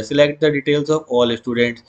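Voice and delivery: very slow, word by word; slow dictation. Shown as a demonstration. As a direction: spell it out for students to copy. Teacher dictating.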